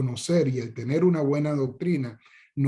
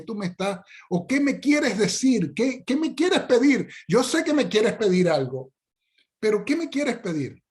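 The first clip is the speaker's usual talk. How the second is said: much higher, exasperated mock outburst; baffled father; 7 semitones higher than usual. Father stops his fawning son, high baffled protest. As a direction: cut the child off, half laughing in disbelief.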